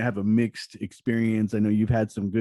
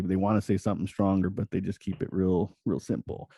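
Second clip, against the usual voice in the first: ordinary talk, nothing marked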